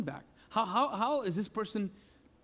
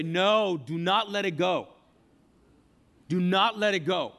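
louder, insistent no; inner voice refusing; 5 dB louder than usual. Retold or imitated. Preacher voicing the inner urge to hold on, loud insistent refusal. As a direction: push back hard, don't let it go. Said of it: "everything inside of you will say".

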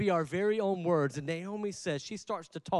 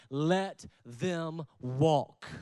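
slow emphasis; 3 semitones lower, much slower than usual. Real, not acted. Preacher telling the church to release those who leave, very slow. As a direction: let them walk, drawn out very slowly. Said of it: "preacher slows for emphasis".

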